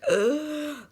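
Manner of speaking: struggling gasp, imitated. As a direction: mimic a desperate gasp for breath. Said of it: "he was just like".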